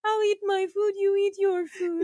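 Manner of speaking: high, slowing, sulky resignation; mock sulk. Woman joins boyfriend's food-sharing act, mock sulk. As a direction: give up in a small wounded voice.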